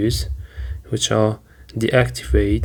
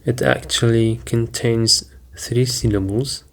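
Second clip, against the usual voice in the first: normal talking voice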